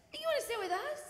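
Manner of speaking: high-pitched friendly invitation; inviting boy. Retold or imitated. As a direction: imitate an eager kid asking you over. Oliver invites her to sit.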